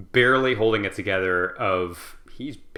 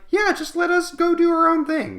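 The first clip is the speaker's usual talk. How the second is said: rising much higher and louder, mock-cheerful; sarcastic brush-off; 5 dB louder than usual. Kutuzov mocking Austrian commander, high sarcastic dismissal. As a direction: toss it off with mocking glee.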